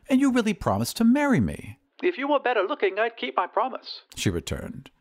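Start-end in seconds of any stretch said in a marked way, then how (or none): 0.1-1.8 high-pitched plea of a jilted suitor; wounded, high pleading. Acted. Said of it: "pleaded the glass blower". 2.0-4.0 high, haughty lady on the telephone; disdainful lady, pitched well up; haughty rich lady. Acted.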